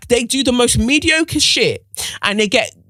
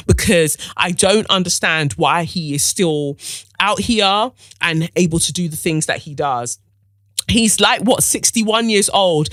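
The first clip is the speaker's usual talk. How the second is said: ordinary talk, nothing marked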